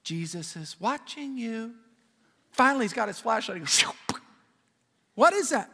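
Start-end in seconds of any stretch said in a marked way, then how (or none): 0.0-1.7 soft, eerie sing-out from a dark corner; a hushed, mysterious warning. Retold or imitated. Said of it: "Here's what he hears". 5.1-5.7 startled. Acted. Burglar startled by voice in dark.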